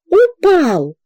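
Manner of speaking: high, loud excited yells; crowd shouting. A narrator's reading. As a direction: call out the news from all sides. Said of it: "кричали со всех сторон".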